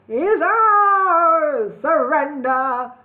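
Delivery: high, loud, slow villain croak; loud horrid goblin king. A narrator's reading. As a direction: gloat over the beaten enemy. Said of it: "said Cluck".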